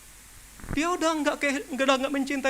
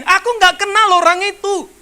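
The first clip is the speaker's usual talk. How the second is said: "Aku nggak kenal orang itu" shouted higher and louder; loud denial; 8 semitones higher, 10 dB louder than usual. Retold or imitated. Preacher re-enacting Peter denying Jesus, loud and higher.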